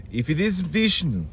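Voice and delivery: hammed-up solemn royal delivery; reverent king Bali. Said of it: "And then the dude was like".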